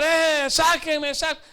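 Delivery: high, drawn-out call from down a pit; mock cry for help. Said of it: "comenzó a gritar".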